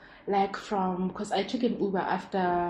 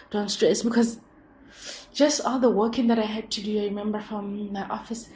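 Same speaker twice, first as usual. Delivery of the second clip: ordinary talk, nothing marked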